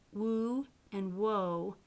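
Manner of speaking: slow Woo and woe with lips strongly rounded. Shown as a demonstration. Teacher showing lip rounding to students, slow. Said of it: "the W becomes very rounded".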